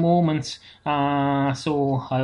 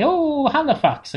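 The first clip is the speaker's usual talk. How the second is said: mock surprise; 10 semitones higher, slower than usual. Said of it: "And then I say".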